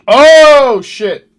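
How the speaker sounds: sudden high yelp, then a curse; jolted by a scare, blurt it out; startled yelp. Real, not acted. Viewer yelps when the game startles him.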